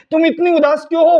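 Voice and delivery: ordinary talk, nothing marked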